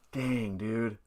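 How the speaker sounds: ordinary delivery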